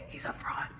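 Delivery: very soft, voiceless whisper; hushed stage whisper; whispered aside. Pretend. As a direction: whisper the verdict like a secret. Whispered verdict on the psychic.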